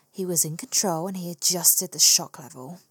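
ordinary delivery

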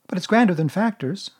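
put-on a small boy catching on; boyish Christopher Robin. A narrator's reading. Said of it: "he added quickly".

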